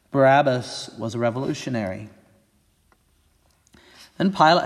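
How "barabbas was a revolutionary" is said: usual speaking voice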